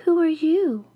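startled question. A narrator's reading. Startled girl asks the youth.

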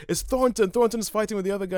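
put-on high-pitched flustered young priest; high fussy young clergyman. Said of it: "It's like".

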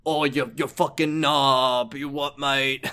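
slow sneering British insult; British accent. Retold or imitated. Punk switches to British accent to insult Kiryu. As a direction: snarl it like a London yob, slowly. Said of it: "he's like".

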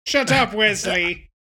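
loud, slow captain's command; mock Picard rebuke. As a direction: draw it out like a stern starship captain. Repeats the Picard quote.

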